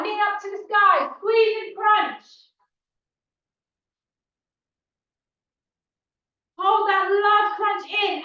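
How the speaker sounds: loud, high workout coaching; aerobics instructor. Acted. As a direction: push them through the last reps. Cues crunches to the class.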